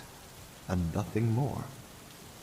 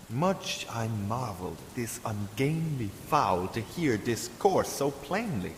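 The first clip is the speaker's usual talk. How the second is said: plain reading voice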